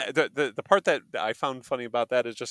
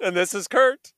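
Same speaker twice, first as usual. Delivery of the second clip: cheery sign-off; 9 semitones higher, 10 dB louder than usual. Acted. Podcast sign-off.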